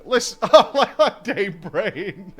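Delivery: helpless real laughter through the words; words breaking into a long laugh; cracking up. Real, not acted. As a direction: talk while losing it laughing. Cracking up at a badly formatted message.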